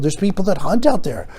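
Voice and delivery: usual speaking voice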